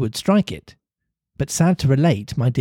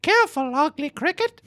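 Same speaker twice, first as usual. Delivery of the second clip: angry Pinocchio. A narrator's reading. Pinocchio threatens cricket.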